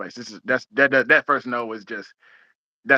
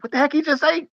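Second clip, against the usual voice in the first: much higher, put-on baffled voice; confused customer. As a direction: play a customer who missed every word. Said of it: "I'm like".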